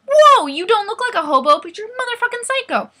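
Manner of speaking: loud, high outburst of disbelief; hammed-up shock. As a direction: burst out on the whoa, stay high in mock outrage. Acted reaction to being strangled.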